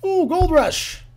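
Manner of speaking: high-pitched excited exclamation. Real, not acted. Player spotting a gold rush in the game, excited.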